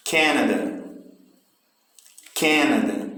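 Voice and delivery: CANADA with force on the first syllable, falling away after it; stress on first syllable. Shown as a demonstration. Teacher drilling syllable stress for students, first syllable of Canada stressed. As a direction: hit the start of "Canada". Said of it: "O início da palavra".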